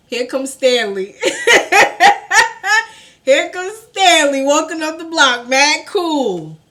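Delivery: real laughter pushing the voice high and loud; loud, high, tickled retelling; laughing. Real, not acted. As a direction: crack up while telling it. Laughs telling of friend's arrival.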